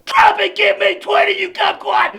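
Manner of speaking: screaming drill sergeant orders; barking gym coach. Acted. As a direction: bark it like a boot camp coach.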